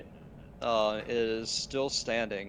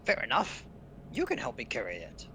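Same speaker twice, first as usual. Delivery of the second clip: goblin voice. Acted. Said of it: "Goblin voices".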